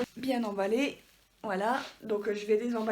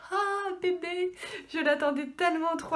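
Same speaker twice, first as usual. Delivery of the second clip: excited squeal; 7 semitones higher than usual. Real, not acted. Excited over awaited manga.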